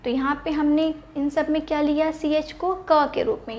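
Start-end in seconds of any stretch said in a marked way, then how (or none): none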